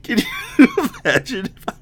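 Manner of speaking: laughing. Real, not acted. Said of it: "laughing while talking".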